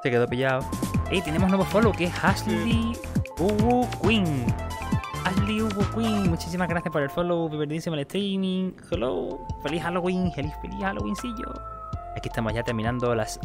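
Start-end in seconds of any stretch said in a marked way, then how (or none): 1.1-11.5 cute anime streamer. Acted. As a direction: greet the follower like a kawaii anime girl. New follower announced, put-on voice. (voz de streamer anime adorable)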